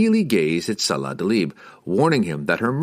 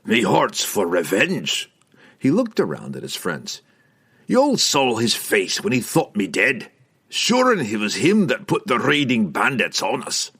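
harsh and severe; 3 semitones higher than usual. A narrator's reading. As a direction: play the bad guy, harsh. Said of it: "play the bad guy role".

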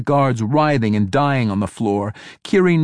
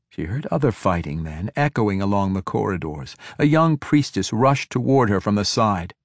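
ordinary delivery

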